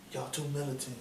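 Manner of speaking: hushed mocking impression; soft mock quote. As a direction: say it softly, mocking his gentleness. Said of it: "he said it soft like that".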